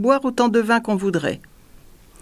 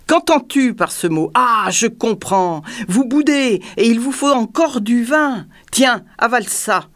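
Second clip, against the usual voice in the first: raised, scornful bullying; ferocious tyrant; 5 semitones higher, 3 dB louder than usual. A narrator's reading. Tyrant forcing more wine on the dwarf, ferocious. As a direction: berate him in a high, fierce voice. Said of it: "cria le tyran férocement".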